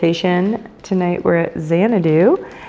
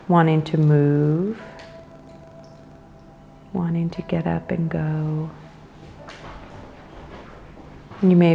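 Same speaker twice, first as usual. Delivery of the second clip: guided meditation. Acted. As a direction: guide them into the breath, unhurried and measured.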